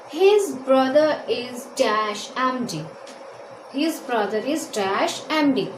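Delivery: very slow, word by word; slow dictation of an English sentence. Shown as a demonstration. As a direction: read it out slowly for students to copy.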